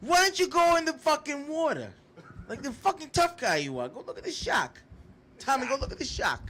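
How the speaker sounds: high, loud mocking tough-guy taunt; Joe Pesci impression. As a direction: needle him like a menacing gangster. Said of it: "Pesci would've came out of me".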